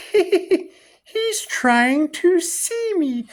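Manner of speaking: very high, slow, giggling fairy voice; strange fairy voice. Retold or imitated. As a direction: squeak it out like a laughing sprite. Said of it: "I hear a strange fairy voice".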